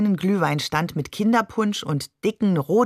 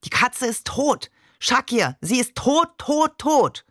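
higher-pitched, exasperated insistence; irritated; 5 semitones higher, slower than usual. A narrator's reading. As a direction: insist with rising irritation, climbing on each repeat. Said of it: "Und dann gereizt".

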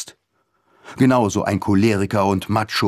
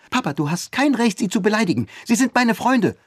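indignant daughter; 14 semitones higher than usual. A narrator's reading. Daughter defending her friends against her father, indignant. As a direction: stand up to your father.

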